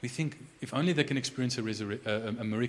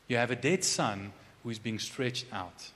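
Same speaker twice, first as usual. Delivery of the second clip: ordinary talk, nothing marked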